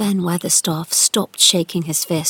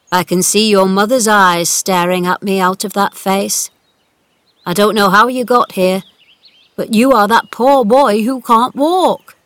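put-on old gardener voice; 6 dB louder than usual.

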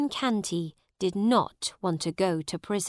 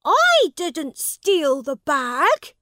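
high, loud, slow denial; boy denying the theft; angry; 8 semitones higher, 8 dB louder, slower than usual. A narrator's reading. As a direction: insist furiously.

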